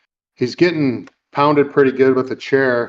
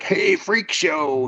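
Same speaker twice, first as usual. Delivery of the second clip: drawn-out, higher taunt; taunting cage fighter; 6 semitones higher, much slower than usual. Retold or imitated. Crazy fighter calls out Peter in the cage, taunting. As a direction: stretch the vowels like a crazy fighter.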